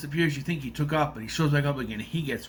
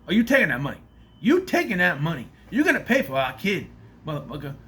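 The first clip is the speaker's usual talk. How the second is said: loud, pushy confrontation over the money; angry demand; 4 semitones higher, 5 dB louder than usual. Retold or imitated. Baby mama confronting him over the money, angry loud demand. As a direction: get in his face about paying for the kid.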